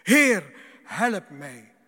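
full-voiced acted cry to the Lord; Petrus crying out. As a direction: shout it out as if sinking. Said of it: "hij schreeuwde het uit".

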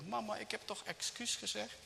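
acted plaintive high voice; pleading son. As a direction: play a child appealing to his mother. Poor son pleads with mother.

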